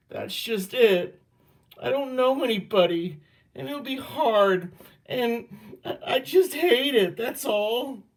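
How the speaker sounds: high, strained voice breaking into a cry; near tears. A narrator's reading. Girl tearfully telling her father she knows nobody. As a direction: fight back tears and lose.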